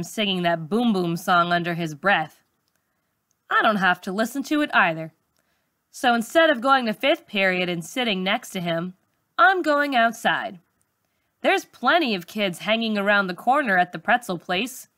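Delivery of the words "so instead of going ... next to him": usual speaking voice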